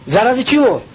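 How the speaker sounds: ordinary talk, nothing marked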